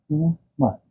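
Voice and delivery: ordinary talk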